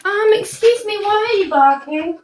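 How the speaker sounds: high-pitched "excuse me" to a dog; high scolding. Real, not acted. Woman on a live telling off her barking dog, high scolding. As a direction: raise the pitch to tell off the dog.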